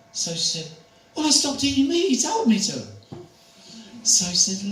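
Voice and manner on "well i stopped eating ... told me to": put-on much higher, louder proud old lady; high earnest granny voice. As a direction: answer simply like a devoted elderly lady. Elderly lady voiced in story.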